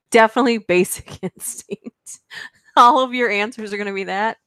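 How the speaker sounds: ordinary delivery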